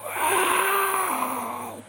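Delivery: high, far-off cry of a big cat; startling jungle roar. Acted. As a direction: let out a wild roar. The jaguar roars at Bichito.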